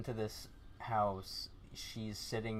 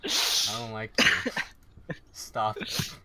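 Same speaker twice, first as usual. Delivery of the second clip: laughing; 5 semitones higher, 8 dB louder, much slower than usual. Real, not acted. GM laughing, breaking character.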